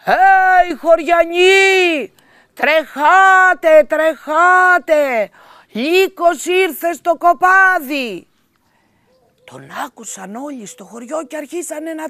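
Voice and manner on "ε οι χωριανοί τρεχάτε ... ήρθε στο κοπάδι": much higher, much louder, slow drawn-out cries; shouting for help. A narrator's reading. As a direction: yell across the valley to the villagers. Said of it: "αρχίζει να φωνάζει".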